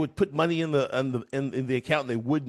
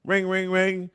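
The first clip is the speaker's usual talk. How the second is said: normal talking voice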